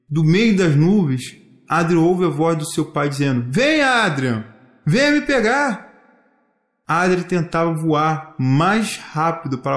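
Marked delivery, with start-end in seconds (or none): 3.5-5.9 high, drawn-out playful call; distant father calling through clouds. Acted. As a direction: call the child to come and chase you.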